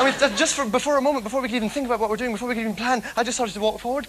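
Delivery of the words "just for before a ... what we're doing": usual speaking voice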